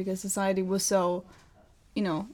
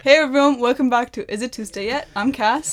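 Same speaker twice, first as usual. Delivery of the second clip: podcast intro; 8 dB louder than usual. Acted.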